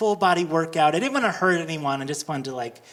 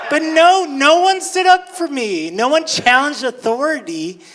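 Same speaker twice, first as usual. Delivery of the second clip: indignant; 8 semitones higher, 8 dB louder than usual. Real, not acted. Indignant punchline, louder.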